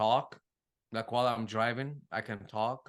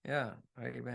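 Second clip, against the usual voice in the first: ordinary talk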